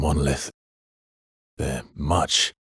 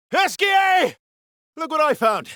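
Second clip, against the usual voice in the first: high, loud excited shout across the distance; excited call; 4 dB louder than usual. Acted. Calling Esquier over to show a discovery, excited shout. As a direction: call out thrilled about a find.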